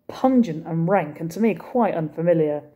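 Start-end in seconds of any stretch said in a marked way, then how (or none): none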